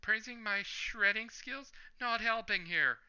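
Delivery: high, whiny mock complaint; lightly voiced moan.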